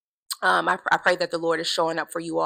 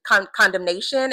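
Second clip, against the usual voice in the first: ordinary delivery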